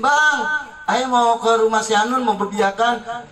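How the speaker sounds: high, sweet, asking nicely; wife asking permission. Retold or imitated.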